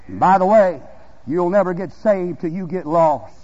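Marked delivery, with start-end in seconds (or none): none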